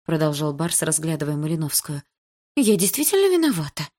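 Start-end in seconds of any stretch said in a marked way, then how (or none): 2.6-3.9 put-on high timid girlish babble; babbling girl. A narrator's reading. Lika meekly admitting guilt to Bars, high and timid. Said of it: "пролепетала Лика".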